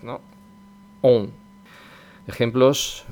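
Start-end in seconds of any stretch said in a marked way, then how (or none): 1.0-1.3 nasal on, O through the nose; French nasal O. Shown as a demonstration. Teacher demoing the French nasal on. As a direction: let the O ring in the nose. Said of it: "una O con algo que le añadimos ahí de la nariz".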